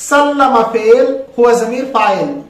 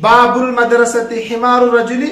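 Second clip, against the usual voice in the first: ordinary talk, nothing marked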